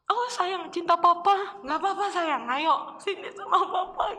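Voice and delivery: a hammed-up loving father with a laugh; tender father. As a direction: open your arms like a relieved dad.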